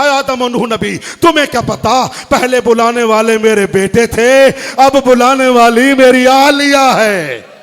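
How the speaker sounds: loud grieving cry; rising, tearful lament; weeping. Acted. Ali answers the prophets, loud and weeping. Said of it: "रोकर कहा".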